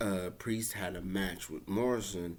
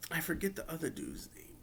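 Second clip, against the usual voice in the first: ordinary talk, nothing marked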